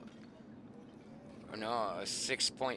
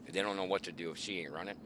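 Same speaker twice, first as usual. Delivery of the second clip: normal talking voice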